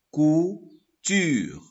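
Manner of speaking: "couture" split into "cou" and "ture"; syllable by syllable. Shown as a demonstration. Teacher showing first and second syllable, "couture" broken in two. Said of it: "Voilà la première syllabe".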